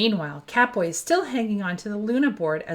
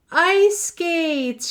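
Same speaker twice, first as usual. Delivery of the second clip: surprised delight; 9 semitones higher, 5 dB louder than usual. Acted. Surprised opening present.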